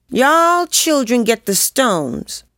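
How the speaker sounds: high, drawn-out bossy order; bossy bravado. A narrator's reading. Lizbeth covering doubt before the other kids, bossy bravado. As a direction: order the little ones about with bravado. Narrator's child self takes charge.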